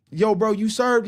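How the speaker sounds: high, loud call. Real, not acted. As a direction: call down the hall. Calling out to the Marine.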